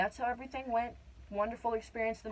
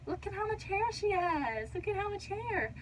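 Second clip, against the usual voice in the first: retold marvelling; 10 semitones higher than usual. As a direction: draw it out high in mock wonder. Retold midwife marvelling at hair.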